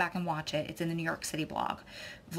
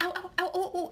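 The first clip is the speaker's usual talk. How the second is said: much higher, playful ow ow ow; mock yelps of pain; 11 semitones higher than usual. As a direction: yelp like a hot bowl burns your hands. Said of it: "and I was like".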